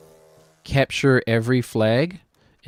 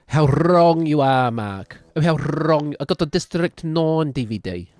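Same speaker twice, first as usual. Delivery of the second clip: put-on South African accent; 4 semitones higher, slower than usual. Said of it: "You just stole that accent".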